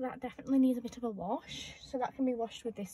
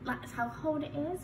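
normal talking voice

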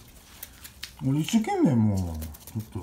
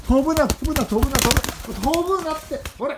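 higher, louder urgent repeated call; urgent don't-fly; 12 semitones higher, 5 dB louder than usual. Real, not acted. Man urging his pigeon not to fly away, high and loud. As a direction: stop the bird from flying off. Bird flies off, he scolds.

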